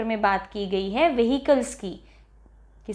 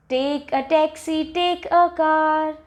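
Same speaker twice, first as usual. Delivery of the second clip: slow sing-song verse; drawn-out children's rhyme chant; poem recitation; 4 semitones higher, much slower than usual. Shown as a demonstration. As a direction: recite it evenly on a held pitch. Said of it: "so let us recite this".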